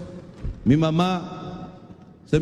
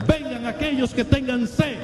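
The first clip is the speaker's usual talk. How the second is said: high, raised exhortation to the congregation; fiery preaching; 6 semitones higher than usual. Acted. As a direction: preach it out, calling them to climb aboard.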